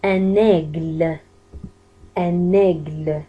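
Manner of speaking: slow "Un aigle", opening the è outward. Shown as a demonstration. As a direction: draw out "aigle" with a wide-open first vowel. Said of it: "وكأنه الإي هذيك خرج فيها برا للخارج".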